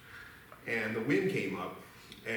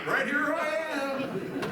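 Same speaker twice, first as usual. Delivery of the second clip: goose voice; 14 semitones higher, 3 dB louder, slower than usual. Pretend. Goose lying on the ice.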